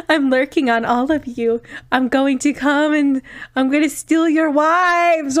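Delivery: loud hammed-up villain boast; villain impression. Villain impression for the stream, loud and hammy. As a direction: bellow it like a cartoon wife-stealer. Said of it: "pretty good impression".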